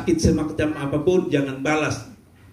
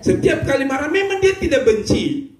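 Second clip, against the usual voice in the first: excited; 10 semitones higher than usual. A narrator's reading. Punchline retold amid laughter.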